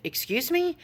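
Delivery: high, rising incredulous question; re-enacted taken-aback challenge; retold affronted disbelief. Customer answering a worker who swore, affronted disbelief.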